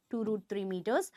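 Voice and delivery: "Two root three meters" repeated softly and low; quiet repeat. Shown as a demonstration. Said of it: "also given as two root three meters".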